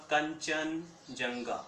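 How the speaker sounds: drawn-out, syllable by syllable; teacher drawing out the peak name "Kanchenjunga" slowly for students; slow spelled-out name. Shown as a demonstration. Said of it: "कांचा से मतलब क्या हो जाएगा?".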